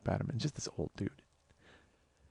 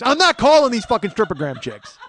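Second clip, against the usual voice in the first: loud, high acted outburst; angry refusal; 4 semitones higher, 7 dB louder than usual. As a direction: explode in indignant refusal, imitating a fed-up friend.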